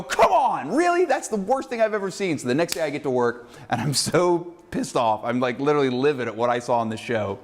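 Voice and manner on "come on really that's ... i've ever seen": higher, incredulous scoff; exasperated disbelief. Retold or imitated. Viewer scoffing at the show's ad, higher exasperated disbelief. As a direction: groan at the TV in disbelief. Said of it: "And I go".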